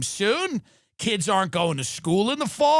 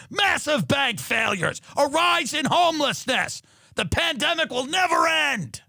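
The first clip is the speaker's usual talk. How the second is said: laughing, then rising into a shout; mock rant; 2 semitones higher than usual. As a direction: gleefully tear into them. Berating hopeful friend on phone.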